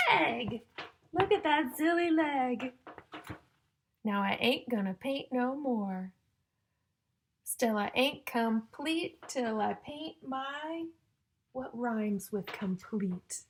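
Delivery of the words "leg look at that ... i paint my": loud, slow, sing-song storybook reading; reading to children. Acted. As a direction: build suspense before each rhyme. Reading rhyming picture book.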